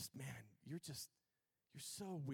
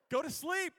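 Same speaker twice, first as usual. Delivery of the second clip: high, loud shout; mock stern order; 11 semitones higher, 11 dB louder than usual. Preacher orders boogeyman believers to bed, shouting. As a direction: yell it like an exasperated bedtime command.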